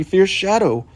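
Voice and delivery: plain reading voice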